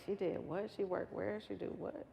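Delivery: understated, soft-voiced wonder; quietly marvel, as if to yourself; hushed recalled disbelief; retold amazement. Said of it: "I would be so amazed".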